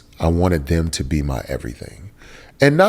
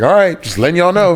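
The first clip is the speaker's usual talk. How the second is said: high, louder playful protest; pitched-up comic self-defence; mock defensive; 11 semitones higher, 5 dB louder than usual. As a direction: jokingly set the guys straight. Joking mock defence to his friends.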